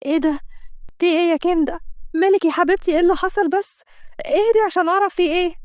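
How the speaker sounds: a lightly voiced anxious friend; worried friend. Friend Camilia worries over Kenda.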